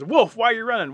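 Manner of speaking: high, loud shouted question; urgent calling voice; rabbit calling out. Acted. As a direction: holler it after a runner. Rabbit calls out to wolf.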